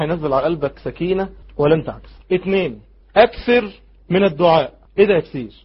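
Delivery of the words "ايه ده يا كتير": ordinary delivery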